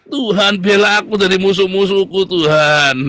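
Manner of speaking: loud, high cry to God; shouted plea. Acted. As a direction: cry out like a desperate psalmist. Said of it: "Mazmur lagi teriak". (berteriak lantang memohon kepada Tuhan)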